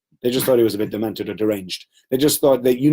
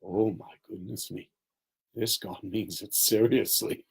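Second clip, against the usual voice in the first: awed fear; 4 semitones lower, 9 dB quieter than usual. Retold or imitated. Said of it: "sitting back going like".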